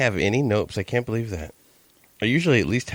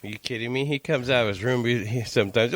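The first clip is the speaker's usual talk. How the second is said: usual speaking voice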